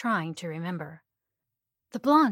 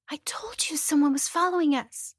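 frightened whisper; 8 semitones higher, 5 dB louder than usual. Acted.

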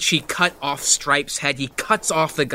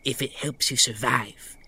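tempting whisper. Acted. Character tempting the vampire.

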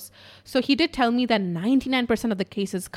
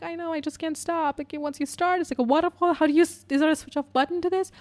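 imitated crying; 7 semitones higher than usual. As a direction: plead helplessly as if unable to stop sobbing. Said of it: "I was crying".

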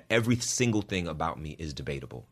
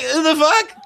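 laughing retort; 13 dB louder than usual. Retold or imitated. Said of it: "I'm like".

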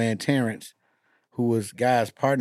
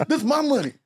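quoting Faison; 13 semitones higher than usual. Retold or imitated. Faison quoted.

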